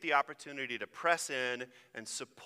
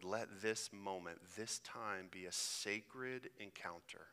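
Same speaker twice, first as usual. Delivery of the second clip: soft, hushed prayer voice; 6 semitones lower, 7 dB quieter than usual. Acted. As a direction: pray gently, drawing people in. Leads opening prayer.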